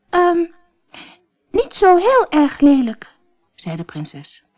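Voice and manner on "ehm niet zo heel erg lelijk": put-on high, hedging girl; hesitant princess. Princess answers the frog, high and hesitant. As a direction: fumble for a kind lie.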